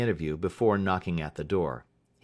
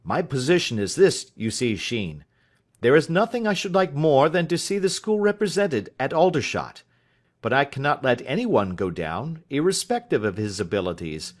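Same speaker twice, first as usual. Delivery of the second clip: higher, lightly voiced hearty schoolmaster; a hint of a flustered genial master; 6 semitones higher, 5 dB louder than usual. A narrator's reading. Master explaining his position.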